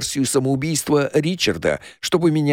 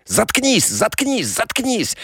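high, strained repeated outburst; enraged; 10 semitones higher, slower than usual. A narrator's reading. Stepfather silencing the accuser as he shoots, enraged. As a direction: hammer each repeat out in a raised pitch.

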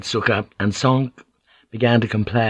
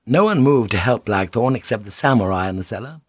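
usual speaking voice

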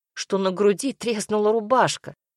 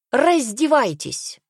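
high, drawn-out command; 6 semitones higher, 3 dB louder, slower than usual. A narrator's reading. Dwarf director orders prince to undress, commanding. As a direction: call the order out slowly. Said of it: "скомандовал гном".

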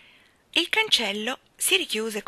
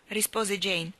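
ordinary delivery, nothing marked

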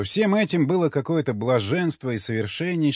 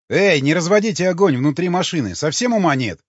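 higher, louder narrated outcry; alarmed exclamation; 5 semitones higher, 5 dB louder than usual. A narrator's reading. Crewman warns comrades against lighting fire inside vehicle, exclaiming loudly. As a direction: exclaim in annoyed alarm, pitch raised. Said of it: "воскликнул обернувшийся Алексеев".

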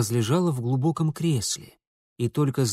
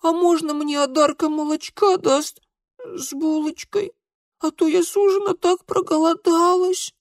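put-on very high, pleading little-girl voice; plaintive child's babble; 5 dB louder than usual. A narrator's reading. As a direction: beg pitifully for food. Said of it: "жалобно-жалобно пролепетала толстушка Алевтина".

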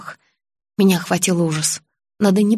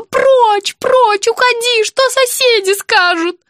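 high, loud cries of banishment; shouting; 13 semitones higher, 4 dB louder, slower than usual. A narrator's reading. Patient echoing her mother throwing her out, shouting. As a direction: cry out to drive someone away. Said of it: "Мама кричала".

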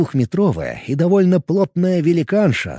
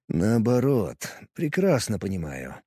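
smirking; 4 semitones lower, 8 dB quieter than usual. Acted. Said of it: "хмыкнул Варп".

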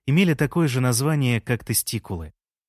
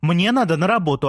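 shouting; 6 semitones higher, 4 dB louder than usual. A narrator's reading. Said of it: "закричала Лея".

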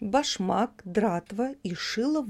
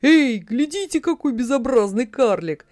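louder, higher mocking call; crowd jeering shout; 4 semitones higher, 6 dB louder than usual. A narrator's reading. Crowd jeering at the dwarf, loud mocking call. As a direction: jeer at the dwarf across the market. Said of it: "все вокруг только и кричали".

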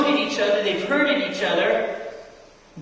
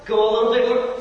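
normal talking voice

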